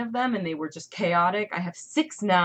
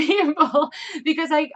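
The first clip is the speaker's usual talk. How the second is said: a burst of real laughter, pitched higher and louder; laughing; 9 semitones higher, 8 dB louder than usual. Real, not acted. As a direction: laugh through the words in disbelief. Laughing while voicing anger.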